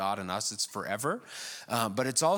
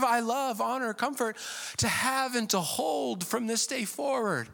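higher, lilting mock vow recital; mock sweet vows; 10 semitones higher than usual. Newlyweds imagined reciting vows, mock lilting. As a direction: coo the vows ironically.

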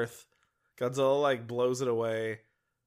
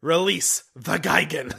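movie quote voice; 6 dB louder than usual. Retold or imitated. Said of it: "I wish I had that sound clip".

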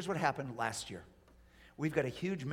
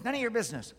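quick, higher-pitched brush-off; indignant retort; 8 semitones higher, 5 dB louder, faster than usual. Acted. Listener rebuffing a friend's warning, indignant. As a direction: snap back at unwanted advice, lightly acted.

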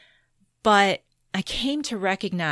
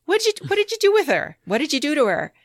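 high, loud, fast accusing mother; alarmed mom; 9 semitones higher, 4 dB louder, much faster than usual. Retold or imitated. Mom confronts dad, loud and fast. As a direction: demand it in a panic, fast and high. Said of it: "she's like".

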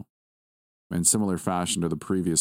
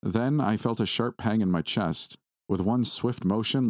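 ordinary delivery

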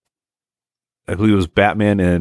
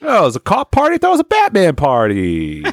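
high, hammed-up party guest confusion; mock embarrassed surprise; 10 semitones higher than usual. Playing the guy in the wrong costume, mock surprise. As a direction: pitch up in mock realization of a mistake.

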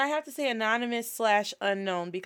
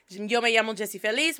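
ordinary talk

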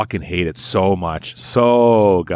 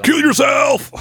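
mock toxic shout; slower than usual. Gamer yelling a mock insult at the group. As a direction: scream it like an angry online troll. Quoting toxic chat messages.

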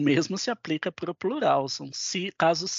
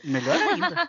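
laughing; 11 semitones higher, 4 dB louder than usual. Real, not acted. Amused reply to a joke.

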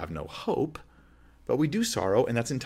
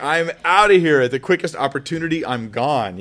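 louder, hasty escape line; mock bailing out; 5 semitones higher, 9 dB louder than usual. Uncomfortable listener fleeing a weeping friend, mock hasty exit. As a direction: announce your getaway. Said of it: "and you're just like".

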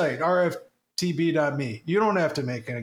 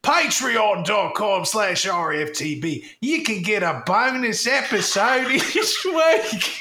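mock Australian accent; 7 semitones higher than usual. Ad spoof drifting into Australian accent. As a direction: sell it in a cartoon Australian voice. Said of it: "devolves into Australian".

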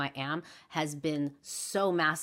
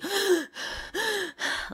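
sharp high gasp for air; imitated panic gasp. Retelling a junior-high anxiety attack before the run, sharp imitated gasp. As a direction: gasp like you can't catch your breath. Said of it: "'Cause I would just be".

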